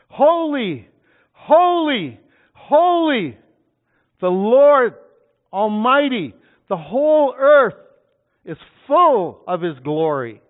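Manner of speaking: shouted high, ringing holy holy holy; seraphs calling out. Acted. Preacher voicing the seraphs, loud high shouting. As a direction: call it out like angels across heaven. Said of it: "They probably even did it louder".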